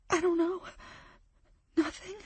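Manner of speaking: high, soft and defeated; sad. Acted. Friend admitting she can do nothing, sad and soft. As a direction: let it sink into hopelessness.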